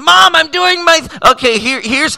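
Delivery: high, loud, flustered mock whine; flustered kid. Amateur voice actor interrupted by mom, flustered and loud. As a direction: call out to mom, high and rattled.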